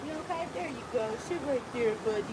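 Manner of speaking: gentle. Real, not acted. Settling her dog down gently.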